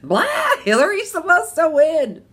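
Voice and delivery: high, loud whiny mimic; whiny mock complaint about the result; mocking family. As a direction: sneer at the upset daughters. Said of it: "It's like".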